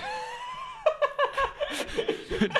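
a long high burst of laughter; high laughing fit. Real, not acted. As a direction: crack up helplessly. Cracks up at the review.

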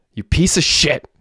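high, strained insult; outraged scream. A narrator's reading. Derek to the gunman who betrayed him, outraged scream. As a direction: hurl it out in a high-pitched cry. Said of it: "screamed Derek".

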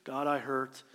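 low, restrained murmur of anguish; soft, timid. Shown as a demonstration. Timid believer praying in anguish, soft and restrained. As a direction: keep the hurt small and stoic. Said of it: "It's not a soft or timid or restrained or stoic expression".

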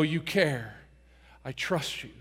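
low, soft surrender; quiet trust. Acted. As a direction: let it out softly, as a relieved prayer. Voices prayer of trust after relief.

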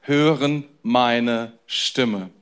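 drawn out, low and slow; slow solemn quote. Retold or imitated. Preacher quoting Jesus to the flock, slow and solemn. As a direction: let each word of Jesus land slowly. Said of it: "sagt er".

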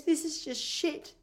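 high, slow, soft mock child voice; upset kid. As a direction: imitate a child's drawn-out, let-down complaint. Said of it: "one kid got so upset".

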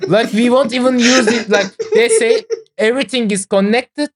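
laughing. Real, not acted. Laughing while explaining.

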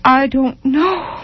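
slow, high, rising despair; anguished cry. A narrator's reading. Tormented mother twisting the rompers, slow anguished rise.

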